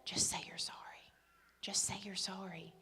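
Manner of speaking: breathy whispered advice, no voice. Acted. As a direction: lean in and whisper it like a secret.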